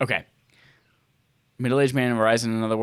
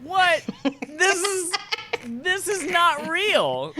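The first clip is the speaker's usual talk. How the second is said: much higher, slow squeal of disbelief; can't believe it, almost laughing; stunned high-pitched protest; incredulous; much slower than usual. Real, not acted. Disbelief at absurd title.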